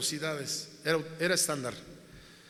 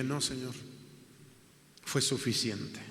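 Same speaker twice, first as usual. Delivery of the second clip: quiet surrender; 6 semitones lower, 7 dB quieter than usual. Retold or imitated. Answering God's voice quietly.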